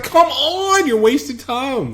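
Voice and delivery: very high, drawn-out whine; mock impatience. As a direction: hurry her along in hammed-up exasperation. Retold boy urging the chimp.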